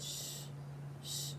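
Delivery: soft shushing; soothing hush. Acted. Father comforting crying son, soft shush. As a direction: hush him gently. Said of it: "patting his head".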